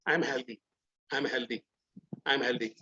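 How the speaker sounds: soft, low self-talk of health; quiet low affirmation. Shown as a demonstration. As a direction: murmur it to yourself, low. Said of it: "डालना". (धीमी, गहरी आवाज़ में ख़ुद से कहना)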